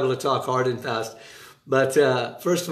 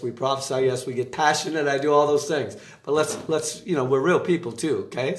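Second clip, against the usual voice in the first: normal talking voice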